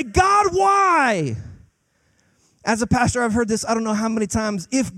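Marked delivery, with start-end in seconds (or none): 0.0-1.4 anguished yell. Acted. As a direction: yell it at heaven, drawing out the why. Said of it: "we wanna yell at God".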